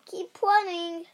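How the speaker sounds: lightly barked command, pitched high; barking dog. A narrator's reading. Dog sending the girl on her way, barking. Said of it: "barked the dog".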